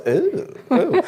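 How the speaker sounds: high, teasing imitated "ooh"; mock dominatrix coo. Host mimics his wife's voice, high mock coo. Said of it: "that's the voice that goes".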